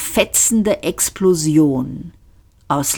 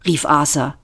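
plain reading voice

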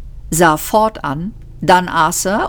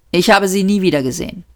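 usual speaking voice

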